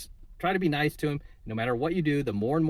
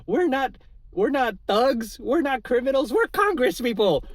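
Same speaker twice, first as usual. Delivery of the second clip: shrill-pitched mock of a congresswoman, much higher and louder; 11 semitones higher, 5 dB louder than usual. Vlogger mocking a congresswoman's denial, high mocking voice. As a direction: parody her prim, indignant denial in a high squeaky voice. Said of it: "She was like".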